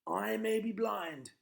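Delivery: loud, high, slow defiant shout; loud high defiance. Acted. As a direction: defy the witch at full voice. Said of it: "he shouted to the witch".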